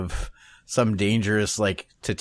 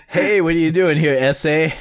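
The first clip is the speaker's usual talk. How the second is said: higher, louder mock gang greeting; mock gangster; 5 semitones higher, 6 dB louder than usual. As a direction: call out like a pretend street tough. Acts confronting the teacher as gangster.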